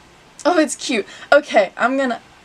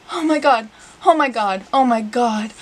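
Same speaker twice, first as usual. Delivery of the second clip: ordinary talk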